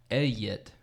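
"Elliot" said as "eh-yit"; double L as Y. Retold or imitated. Speaker imitating grandma saying Elliot as eh-yit. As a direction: say Elliot with a y sound replacing the L's. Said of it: "she does the two L's like a Y".

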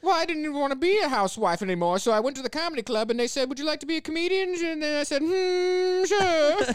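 high, bright 1950s housewife voice; chirpy old-fashioned housewife impression. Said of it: "a nineteen fifties housewife voice that I was going for".

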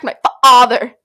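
loud re-enactment of a cracked voice; voice-crack replay. Shown as a demonstration. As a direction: exaggerate the break on "father". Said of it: "You hear how my voice cracked?".

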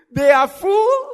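loud, slow, laughing; laughing shout. Acted. Laughing emphatic preaching.

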